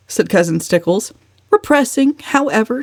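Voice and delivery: ordinary delivery, nothing marked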